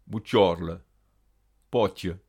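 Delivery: "bucciorle" and "potier" with a palatal ty-ch sound; Gascon CH as tche. Shown as a demonstration. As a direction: press the tongue for tch in "bucciorle", "potier". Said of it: "se prononce comme en espagnol tche". (« bucciorle », « potier » avec tche mouillé)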